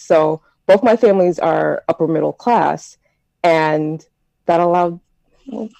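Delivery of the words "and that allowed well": ordinary talk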